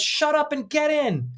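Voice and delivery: high-pitched mock yell; rude husband. Husband cutting off his wife during the air raid warning, rude mock yell. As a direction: imitate an exasperated husband ordering someone about. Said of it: "he's quite rude to his wife".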